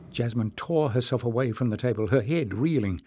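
ordinary delivery

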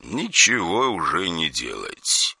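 soft, slow, cold finality; like a verdict. Acted. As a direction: pronounce it like a sentence. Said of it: "словно выносили приговор".

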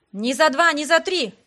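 loud, emphatic refusal; crying out. A narrator's reading. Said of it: "вскричал Торнпип". (выкрикивая)

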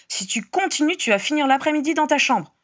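high-pitched, cross parental warning; scolding threat. A narrator's reading. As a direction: lay down the punishment crossly. Parent threatens child with punishment. (menace de parent fâché)

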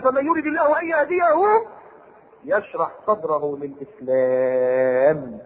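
very slow chanted verse; Quranic recitation. Acted. Preacher reciting to congregation, slow chant. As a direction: stretch the last word out.

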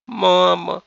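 high, wailing appeal; whining, beseeching cry; plaintive drawn-out plea. A narrator's reading. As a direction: stretch the word out pitifully. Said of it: "жалобно протянула невестка".

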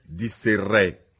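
ordinary delivery

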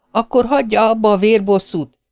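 high-pitched involuntary cry; calling after someone. A narrator's reading. Maisie crying out after the departing man despite herself. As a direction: call out after him, pitch shooting up. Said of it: "kiáltotta utána Maisie akarata ellenére".

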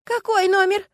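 high, loud, frantic woman's voice; panicked woman sobbing into the phone; Lyuska, tearful woman. A narrator's reading. Ex-wife on the phone to Stas, frantic and tearful.

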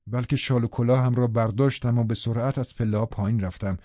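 ordinary delivery

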